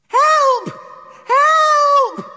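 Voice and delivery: very high, loud, frightened cry; piercing scream. Acted. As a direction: scream for help in terror. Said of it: "there came a piercing scream".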